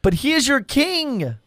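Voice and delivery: high, lifted Pilate voice; voicing Pilate. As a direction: lift the voice high to quote Pilate. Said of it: "and he says".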